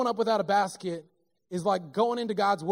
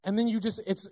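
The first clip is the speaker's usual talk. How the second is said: usual speaking voice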